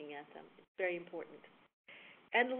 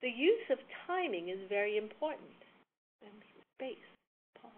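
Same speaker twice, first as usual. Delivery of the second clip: "important" set off by a held silence before "space, pause"; deliberate emphatic timing with long pauses; dramatic pause. Shown as a demonstration. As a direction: land the line, then let the silence speak. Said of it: "something that I wanted to really emphasize".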